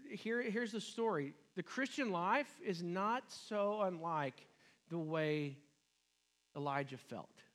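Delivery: ordinary talk, nothing marked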